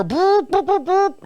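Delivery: high buzzing imitation of a leaf blower; playful mock machine whirr; blower noise. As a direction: buzz it out like a handheld blower. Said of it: "my little handheld blower".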